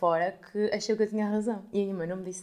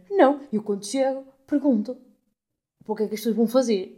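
high, loud, nasal-free mock of a huffy lady; indignant lady; 4 semitones higher, 10 dB louder than usual. Mocking the rude lady in the bank queue, indignant voice. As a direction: mimic the indignant old lady. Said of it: "E a senhora".

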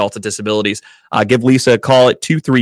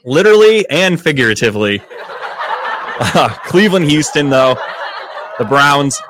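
giggling, pitched up, words half laughed; laughing; 3 semitones higher than usual. Real, not acted. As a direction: keep laughing as you talk. Cracking up at the joke.

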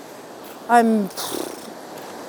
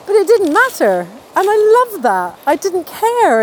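excited; 8 semitones higher, 5 dB louder than usual. Real, not acted. Laughing off strangers' looks.

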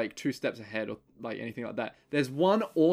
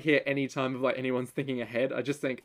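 ordinary delivery

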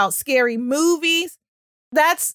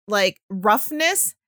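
ordinary delivery

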